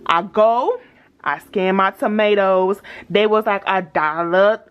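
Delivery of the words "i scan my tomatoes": ordinary talk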